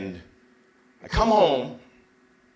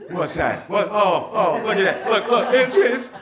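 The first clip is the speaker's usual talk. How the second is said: rising, louder, laughing giddiness; awestruck excitement; 8 semitones higher, 6 dB louder than usual. Retold or imitated. Fans spotting Clive Barker in the room, giddy laughing excitement. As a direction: gasp and point like a kid at Oz.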